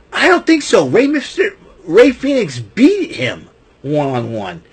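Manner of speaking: scoffing. Real, not acted. Reacting to Escobar's claim with high scoffing. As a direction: shoot the idea down.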